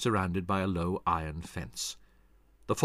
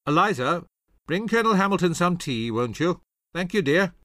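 loud, high genial request; hearty fatherly call, raised voice; calling to daughter; 10 semitones higher, 8 dB louder than usual. Acted. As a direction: call across the house for tea. Father calls out to his daughter.

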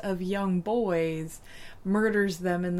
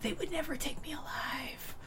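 hushed, mock-dramatic defiance; secretive stage whisper of defiance; whispered vow; 6 semitones higher, 11 dB quieter than usual. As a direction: drop to a soft voiceless whisper. Mock defiant vow about herself.

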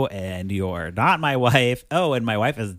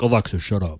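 1 mock gruff command; 3 semitones lower, 4 dB louder, faster than usual. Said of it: "he's like".

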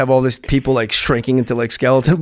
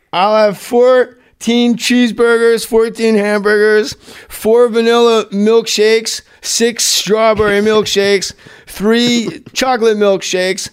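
higher, slow, item-by-item ordering; acted drive-thru order; 6 semitones higher, slower than usual. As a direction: draw out each item of a huge order. Acting Farley's huge drive-thru order.

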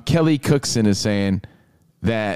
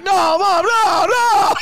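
very high, loud crying whine; mock sobbing protest; whining kid; 5 dB louder, slower than usual. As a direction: wail like a kid in a tantrum. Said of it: "And he's like".

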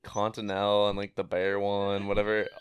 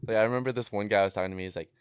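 ordinary talk

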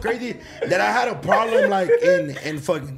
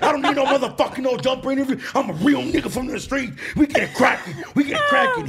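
higher mock-angry rant climbing to a shout; increasingly mad; 6 semitones higher than usual. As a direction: imitate a hothead working himself up. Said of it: "he just kept getting more mad".

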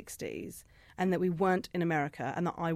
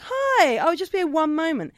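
high, loud, sing-out hello; hammed-up customer-service brightness; exaggerated cheery greeting; 9 semitones higher, 9 dB louder, slower than usual. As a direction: chirp it like an over-friendly waitress. Mimics own cheery greeting, exaggerated.

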